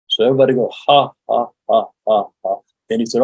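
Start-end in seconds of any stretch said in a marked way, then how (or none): 0.9-2.6 deliberate, evenly spaced "ha" laugh; forced practice laugh. Shown as a demonstration. Workshop leader has the group practice laughing, forced ha-ha laugh. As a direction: fake a laugh one syllable at a time. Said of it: "We're going to practice laughing".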